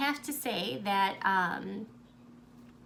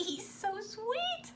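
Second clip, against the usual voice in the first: high, drawn-out cooing over something cute; gushing adoration; 11 semitones higher, 4 dB quieter, much slower than usual. Real, not acted. Vlogger adoring a bunny on her gift, gushing coo.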